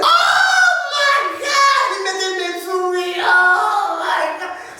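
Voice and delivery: very high, slow, sing-song wail of a mother; mom wailing. Retold or imitated. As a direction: wail it like his mother overdramatizing. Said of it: "She said".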